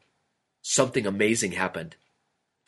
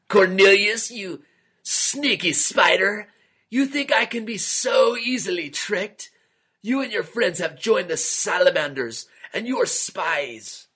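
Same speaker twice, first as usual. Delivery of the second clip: angry yell; 9 semitones higher than usual. A narrator's reading. King yells at Cornelius, angry high shout. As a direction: yell at the spy in a high rage. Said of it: "Then he yelled".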